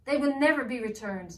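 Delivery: villain shouting at elf; angry shout. Acted. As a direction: bellow it at the servant. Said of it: "shouted angrily".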